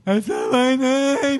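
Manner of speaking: slow high playful protest; mock indignant. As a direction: drag it out in fake offense.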